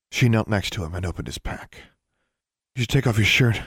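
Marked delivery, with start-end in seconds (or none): 2.8-3.6 firm, mild command; understated order. A narrator's reading. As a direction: give it as a brisk instruction. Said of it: "she ordered".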